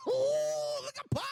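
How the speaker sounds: very high, laughing shriek; laughing squeal. Real, not acted. Laughing at Pac's reaction.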